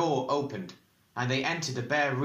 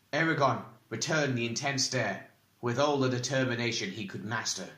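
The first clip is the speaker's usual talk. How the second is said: ordinary delivery, nothing marked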